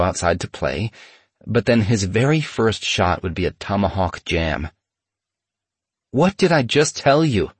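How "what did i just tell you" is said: higher, annoyed big-brother scold; exasperated scolding. Retold or imitated. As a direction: call him out, exasperated. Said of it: "I'd say".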